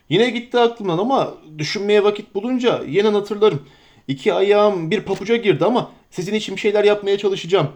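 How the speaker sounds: a flustered, fussing landlord; bustling innkeeper. Acted. Innkeeper Butterbur welcoming the hobbits, eager and fussy.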